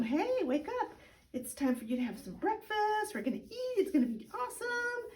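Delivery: put-on high, sing-song coaxing to a sleepy baby; cooing wake-up voice. Shown as a demonstration. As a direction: coo the baby awake, lilting and high. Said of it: "keep bugging the baby and talking to him".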